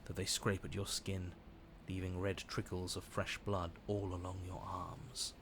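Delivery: calm low storytelling voice; story narration. A narrator's reading. Narrates the game scene.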